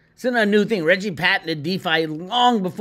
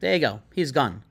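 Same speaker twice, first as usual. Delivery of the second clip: ordinary talk, nothing marked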